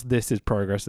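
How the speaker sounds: ordinary delivery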